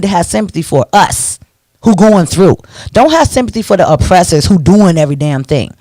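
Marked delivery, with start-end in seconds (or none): none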